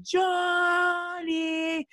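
long high-pitched holler of a name; mock yelling. Player taunting a rival from the dressing room, mock yell. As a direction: call it out drawn-out and high, without full volume. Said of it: "then I'm just yelling in my".

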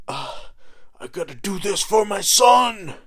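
a high, loud, straining battle cry; a dying father shouting to rise for his son; heroic shout. Retold or imitated. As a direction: yell it with your last strength. Johnny's last effort acted.

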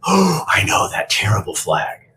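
mock the critics' horror; sarcastic mock outrage. Mocking people who judged the flag boy, sarcastic.

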